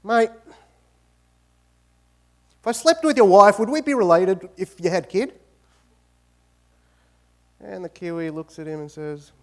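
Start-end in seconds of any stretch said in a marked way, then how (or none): 0.0-5.3 cheeky mate, a touch higher and louder; teasing Aussie builder. Retold or imitated. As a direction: rib your Kiwi mate with a grin. Aussie mate teasing Kiwi in joke.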